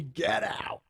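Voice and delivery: high, theatrical dismissal; old thirties movie. Retold or imitated. Throwing donor out, old thirties movie voice. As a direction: order them off like an old-film actor. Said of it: "like an old thirties movie".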